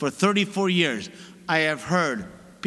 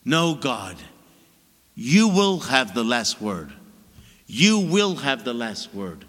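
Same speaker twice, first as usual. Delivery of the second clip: low, slow, calmly certain; quiet confident prayer; 9 semitones lower, slower than usual. Shown as a demonstration. Priest modelling trusting prayer for worried believers, low and slow. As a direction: pray it low and sure. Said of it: "talk to him in that way".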